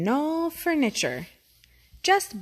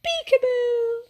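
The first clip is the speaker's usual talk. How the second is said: put-on little boy; 13 semitones higher, 5 dB louder than usual. A narrator's reading. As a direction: sing it out like a toddler hiding. Second boy peekaboo.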